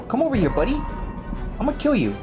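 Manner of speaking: normal talking voice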